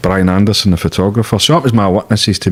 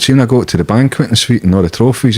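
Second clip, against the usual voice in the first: normal talking voice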